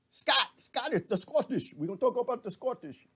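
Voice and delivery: put-on high-pitched playful Scottish accent; mock Scottish accent. Host teasing viewers about Scotland, mock Scottish accent. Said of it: "I did an accent".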